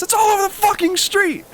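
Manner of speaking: high, whiny bratty scream; high whining yell at his friends. Retold or imitated. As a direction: scream it like a spoiled kid. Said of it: "he screams at us".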